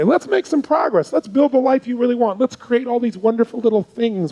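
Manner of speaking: high, bright, sing-song mock positivity; mock cheerful pep talk. Shown as a demonstration. Coach mocks the cheerful approach that would not work. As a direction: overdo the upbeat coach to show it fails. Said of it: "'Cause if I just say".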